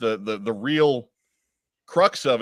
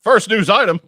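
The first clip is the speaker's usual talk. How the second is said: news announcer; 10 semitones higher, 9 dB louder than usual. Acted.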